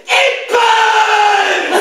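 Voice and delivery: high, loud hammed-up scream of a burning demon; mock demon shriek. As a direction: shriek like holy water scorches you. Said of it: "my reaction was to go".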